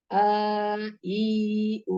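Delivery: "ആ, ഈ, ഊ" each vowel held long. Shown as a demonstration. Teacher previews long vowels for students, holding each one. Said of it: "long vowels ആണ്".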